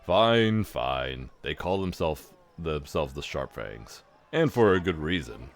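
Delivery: a hint of a gruff half-orc; lightly voiced mocking half-orc; Ragongar voice. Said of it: "I can only do the Ragongar voice".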